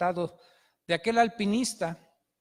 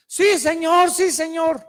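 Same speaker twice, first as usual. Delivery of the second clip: much higher, loud, eager cries; relieved pleading climber; 6 semitones higher, 7 dB louder, slower than usual. Acted. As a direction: grab at rescue with joyful desperation. Climber assures God.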